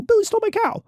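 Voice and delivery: high-pitched plea "Billy stole my cow", with Billy jumping up; complaining to grandpa. Retold or imitated. Grandson reporting the theft to grandpa, high and aggrieved.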